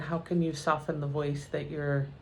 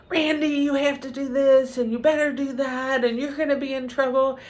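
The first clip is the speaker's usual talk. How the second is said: much higher, louder scolding yell; mom yelling at her kid; 8 semitones higher, 6 dB louder than usual. Retold or imitated. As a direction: yell at Randy like a fed-up mom.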